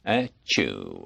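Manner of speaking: a low, soft fake sneeze; Gregory Peck sneezing impression; mock sneeze. Shown as a demonstration. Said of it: "Gregory Peck sneezing".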